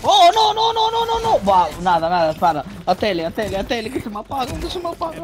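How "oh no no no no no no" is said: panicked. Real, not acted. Panicking as the enemy attacks.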